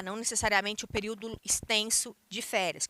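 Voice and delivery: ordinary talk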